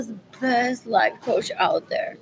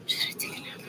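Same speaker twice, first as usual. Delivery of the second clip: sleepy whisper; 12 dB quieter, much faster than usual. Real, not acted. Sleepy on the bus.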